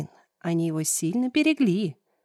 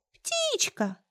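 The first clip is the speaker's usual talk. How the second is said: very high tiny squeak; thin little voice; 4 dB quieter than usual. Acted. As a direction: chirp it like a little bird. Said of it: "тоненьким голоском".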